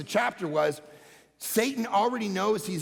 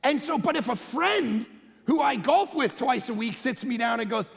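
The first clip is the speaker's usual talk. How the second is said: normal talking voice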